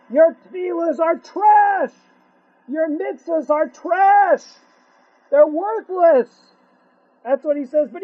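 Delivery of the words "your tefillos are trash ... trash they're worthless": slow, loud shout with every word hammered; God's rebuke. Acted. God scolds men who wrong their wives, slow furious shout. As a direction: thunder it down as God's verdict. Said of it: "Do you know what Hashem says?".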